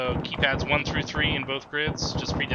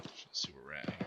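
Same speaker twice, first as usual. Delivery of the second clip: whispered mutter; 11 semitones lower, 14 dB quieter than usual. Real, not acted. Muttering to himself over map.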